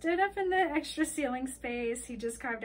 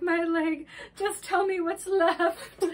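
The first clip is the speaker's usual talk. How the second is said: high, loud comic dread; mock panic; 6 semitones higher, 7 dB louder, slower than usual. Girl thinks her leg burned off, hammed-up panic. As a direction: overdramatic wail, drawn out at the end.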